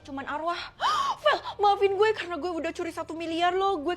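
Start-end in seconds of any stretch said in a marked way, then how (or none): 0.7-1.2 a sudden high startled gasp; sharp gasp. Acted. Friend gasps in shock before confessing the theft.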